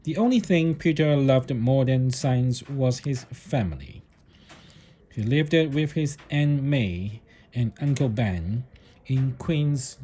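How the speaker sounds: usual speaking voice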